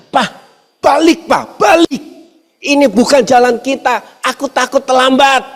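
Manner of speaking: put-on a scared kid begging dad to turn back; panicking child. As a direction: son begging his father to turn the car around, high and frightened. Child panicking on unfamiliar road.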